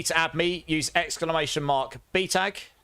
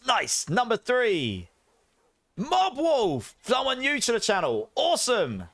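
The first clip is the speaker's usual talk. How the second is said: high, drawn-out game-show name call; excited winner announcer; 9 semitones higher, slower than usual. Acted. Streamer announcing giveaway winners to viewers, hyped announcer.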